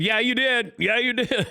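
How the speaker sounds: accusing. Retold or imitated. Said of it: "the other caddy's going".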